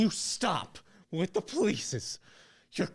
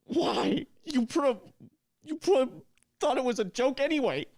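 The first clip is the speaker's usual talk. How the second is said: angry outburst; 8 semitones higher than usual. Acted. Angry at best friend.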